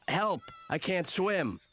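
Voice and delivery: drowning swimmer. Acted. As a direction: stretch out the cry for help. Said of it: "I like when you do both parts".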